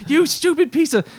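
high, loud angry snap at the phone; angry outburst. Retold or imitated. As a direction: yell at the phone in frustration. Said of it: "I'll get really angry and I'm like".

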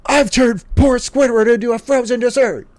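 high, loud cartoon voice; high, loud put-on voice for a silly cartoon confession; cartoon villain voice. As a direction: camp it up as a gleeful cartoon baddie. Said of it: "he just immediately is like".

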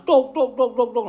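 clacking wooden-drum sounds; kentongan knock. Retold or imitated. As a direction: tap out tuk tuk like a slit drum. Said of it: "Terus engko dikentongi ketoprak".

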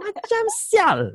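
very high, slow sing-song on "Macam sial"; sing-song insult. Retold or imitated. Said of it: "that sing-song kind of intonation".

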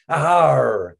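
pirate growl. A narrator's reading. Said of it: "he growled".

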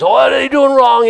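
high-pitched mock busybody pouncing on a fault; fault-finding Pharisee. Mocking people who pick at others' lives, high fault-finding voice. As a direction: imitate a nosy fault-finder, pitched well up. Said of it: "Don't be a Pharisee".

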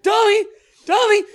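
high, loud call across the room. Retold or imitated. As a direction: shout the dog's name to wake her. Calling the dog awake.

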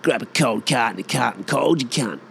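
Aussie larrikin tongue-twister; Aussie drive-through beer order; broad Aussie order. Acted. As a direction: run the words together in thick Aussie. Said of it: "the most Aussiest thing you'll ever fucking order".

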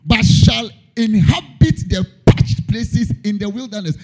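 preekt de Schrift met vuur; fervent sermon reading; preaching. Acted.

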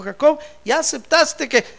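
loud, high, insistent questioning; God demanding an answer. Acted. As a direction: press him loudly, question after question. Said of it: "le dijo".

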